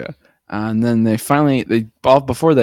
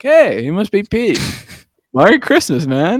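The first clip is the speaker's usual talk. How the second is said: cheery high voice; 7 semitones higher than usual. Retold or imitated. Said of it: "He's like".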